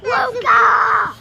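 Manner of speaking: real full-voiced yell; calling out. Real, not acted. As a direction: holler the name suddenly. Child calling out suddenly.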